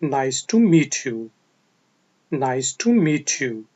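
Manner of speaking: slow, careful teacher model of the phrase; measured classroom pronunciation. Shown as a demonstration. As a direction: say each word slowly for learners to copy. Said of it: "तो आप कहेंगे".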